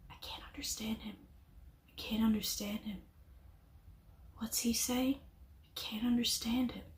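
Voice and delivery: breathy whispered complaint, barely voiced; imitating whispers. Retelling the family muttering behind her in court, in whispers. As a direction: mimic the family's hushed grumbling behind her. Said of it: "I just hear behind me".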